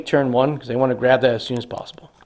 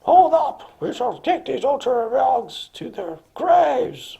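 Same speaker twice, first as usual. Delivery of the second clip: much higher, slow villain voice; Slurm's war cry; 12 semitones higher, much slower than usual. Acted. Slurm vowing to kill the Ultramarines, hammed-up villain. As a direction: ham up a Death Guard warlord's boast. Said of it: "Slurm goes".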